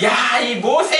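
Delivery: high, loud furious shout at Moses; angry yell. Acted. Israelites yelling furiously at Moses, angry shout. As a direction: bark the name like an outraged crowd.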